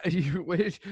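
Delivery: normal talking voice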